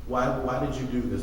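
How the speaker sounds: usual speaking voice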